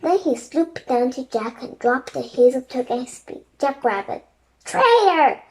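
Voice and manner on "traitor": high, loud furious shriek. A narrator's reading. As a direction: scream it at the traitor. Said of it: "the Raven King shrieked".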